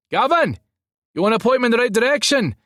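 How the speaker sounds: high, loud call. A narrator's reading. Driver asks gate guard for directions, calling out. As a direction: raise the voice to someone outside.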